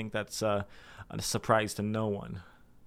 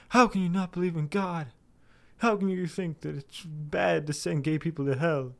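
higher, put-on accusing voice of a critic; mock confrontational challenger; 7 semitones higher than usual. A critic confronting the speaker, mock accusing voice. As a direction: mimic someone grilling him about God.

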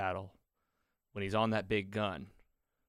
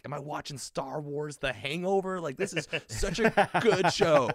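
higher, louder, slowing to a thrilled gush; excited disbelief; 7 semitones higher, 7 dB louder than usual. Retold or imitated. Fan watching the finale, thrilled and loud. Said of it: "I'm sitting here watching like".

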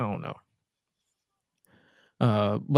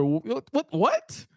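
incredulous; 10 semitones higher, slower than usual. Retold or imitated. Acted outraged reaction to a line.

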